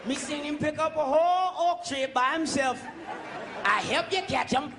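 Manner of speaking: a hint of an African accent pointing someone out; mock African villager. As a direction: sell out the big strong guy proudly. Voicing African slave catcher.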